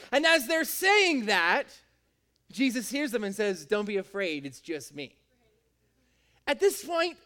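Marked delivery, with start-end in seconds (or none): 3.7-5.2 low, soft, understated reassurance; calm reassurance. Acted. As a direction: settle frightened friends, dropping to a quiet low. Jesus calms the panicking disciples.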